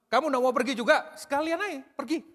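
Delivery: high, loud challenge that drives them out; challenging dismissal. Acted. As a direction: dare them to go, pitched well up. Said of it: "ini sebuah tantangan atau pengusiran".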